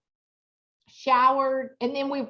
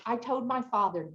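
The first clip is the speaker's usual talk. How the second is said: ordinary delivery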